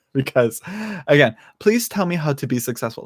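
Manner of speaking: ordinary talk, nothing marked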